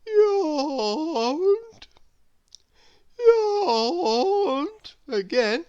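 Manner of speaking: big drawn-out yawn, high and loud; slow sleepy yawning through the words. A narrator's reading. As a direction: stretch it into a huge sleepy yawn. Narrator acts out Lazy's yawns.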